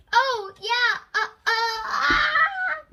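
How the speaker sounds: high, loud rising panic into a yell; panicked realisation. A narrator's reading. Captain and first mate realising penguins can't fly, panicked yell. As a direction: squawk in panic as you start to fall.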